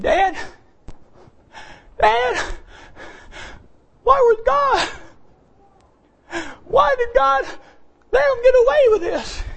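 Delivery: high, pleading daughter's voice; crying daughter. Retold or imitated. Preacher voicing his daughter on the phone, high and pleading. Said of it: "she's crying".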